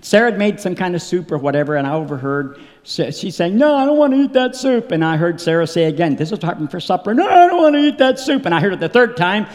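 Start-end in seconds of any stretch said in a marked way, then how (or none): 3.6-4.9 put-on higher-pitched whiny little girl; child's refusal. Voices daughter refusing soup at supper. 7.1-8.5 high, loud imitation of a balking child; insistent child's refusal. Daughter refuses again, more insistently.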